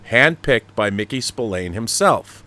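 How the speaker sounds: normal talking voice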